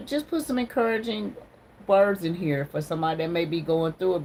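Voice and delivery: normal talking voice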